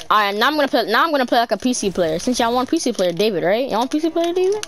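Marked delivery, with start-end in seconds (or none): none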